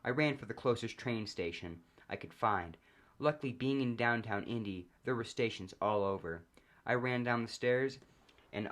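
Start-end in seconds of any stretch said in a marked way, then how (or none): none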